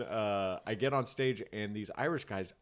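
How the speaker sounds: ordinary delivery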